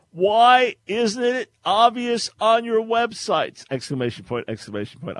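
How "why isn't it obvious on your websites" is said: slow, high-pitched mock yell; all-caps shout. Host reading a frustrated listener's post, shouted in caps. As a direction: holler each word as if typed in capitals. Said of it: "This is all caps".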